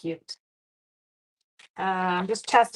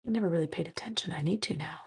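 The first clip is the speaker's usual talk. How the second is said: whisper; 7 dB quieter, faster than usual. Real, not acted. Whispered aside after husky voice.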